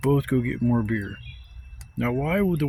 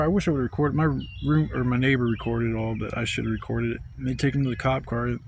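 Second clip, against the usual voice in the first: usual speaking voice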